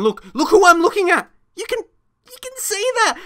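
very high, excited indignant cries; outraged pointing out. Real, not acted. Outraged at game replay.

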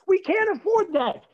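very high, loud protest; father shouting back. Retold or imitated. Father objecting to second mortgage, shouting. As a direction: imitate an exasperated father yelling.

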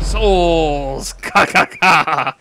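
slow, lightly put-on demon voice; hammy low demon cackling. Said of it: "that's a terrible demon voice".

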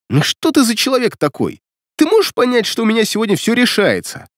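high, aggrieved appeal; angry exasperation. A narrator's reading. Демин demanding that Светлана understand him, exasperated. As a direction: plead crossly with pitch well up. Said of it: "осерчал Демин".

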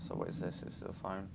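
near-silent, breathless whisper; scared whisper. Real, not acted. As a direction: tiptoe your voice through the dark. Scared creeping through dark level.

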